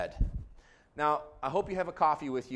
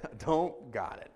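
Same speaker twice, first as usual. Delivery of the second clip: slow comic letdown; deflated admission. Retold or imitated. Pastor replaying Chunk as life stops being fine, deflated admission. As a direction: drop back down and draw out the defeat.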